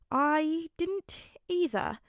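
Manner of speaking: slow, high, questioning; half a question, trailing uncertainly; unsure. A narrator's reading. Midoriya unsure about his dad's gift, slow and questioning. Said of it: "asked more than stated".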